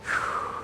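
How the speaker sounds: long audible breath out; demonstrative exhale. Retold or imitated. Mocking guys trying to breathe stress away, exhale. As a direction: blow the stress out in one breath. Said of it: "and then they go".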